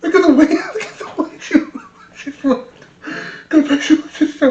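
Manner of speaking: high, breaking up with real laughter; laughing while talking. Real, not acted. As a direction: try to explain through helpless laughter. Cracking up at the clip.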